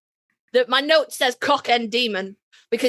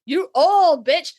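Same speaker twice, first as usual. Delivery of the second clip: a sneered insult, higher, louder and slow; mock sneer; 6 semitones higher, 4 dB louder, slower than usual. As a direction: spit it out slowly with a mocking sneer. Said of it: "He's like".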